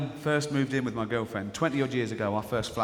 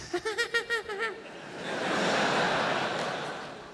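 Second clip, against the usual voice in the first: squealing mock giggle; high giggle. Audience woman giggling at the warm-up man, high squealing giggle. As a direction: giggle high like an excited fan.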